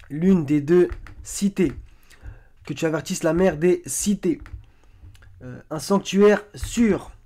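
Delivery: slow, loud, deliberate quotation; emphatic verse reading. Acted. Presenter listing verses on the cités, emphatic reading. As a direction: punch out each excerpt, landing on the last word.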